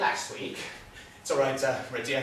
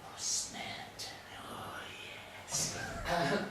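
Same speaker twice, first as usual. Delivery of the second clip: slow whisper; drawn-out, voiceless murmur; hushed musing; 12 dB quieter, slower than usual. Acted. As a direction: whisper it slowly, lost in thought. Wistful listing of forgotten senses.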